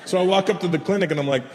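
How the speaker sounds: ordinary delivery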